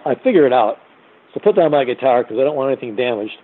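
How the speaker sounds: usual speaking voice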